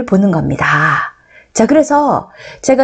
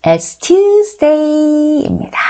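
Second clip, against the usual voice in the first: very slow, higher drill voice; slow model answer; 6 semitones higher, much slower than usual. Shown as a demonstration. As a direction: stretch it out for the class to repeat. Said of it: "자, 여러분 따라하세요". (따라 하도록 아주 천천히)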